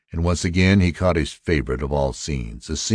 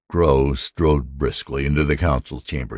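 ordinary delivery, nothing marked

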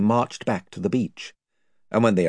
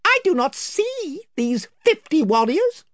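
much higher proud little mouse voice; Reepicheep; 3 dB louder than usual. Acted. Reepicheep to the invisible voices, high and proud. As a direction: speak up small but haughty. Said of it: "observed Reepicheep".

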